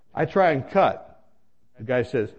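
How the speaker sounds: normal talking voice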